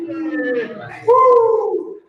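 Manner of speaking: excited cheer. Real, not acted. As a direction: whoop it up for the crowd. Cheering about wrestling panel.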